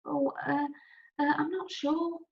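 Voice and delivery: put-on hesitant, nervous old man; afraid old man. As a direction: stammer it out, a little scared. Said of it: "He sounds almost afraid".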